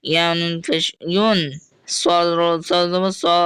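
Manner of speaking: ordinary talk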